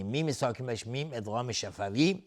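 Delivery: usual speaking voice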